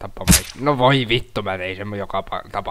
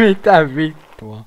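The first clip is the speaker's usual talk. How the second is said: slow, higher, drawn-out; stunned disbelief; 6 semitones higher, 6 dB louder, slower than usual. Real, not acted. Gamer stunned by a fail, slow disbelief. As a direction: stretch it out in shock.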